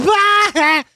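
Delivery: sharp high yelp of alarm, laughing; a sudden startled "Ah!" jumping way up; mock scream from a dream; retold dream yelp. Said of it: "I remember laughing so hard because I was like".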